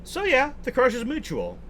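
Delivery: excited. Real, not acted. Gushing over game romance.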